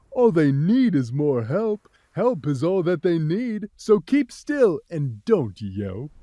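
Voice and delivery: put-on calm the kids down, slightly low; the cat reassuring. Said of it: "said the cat".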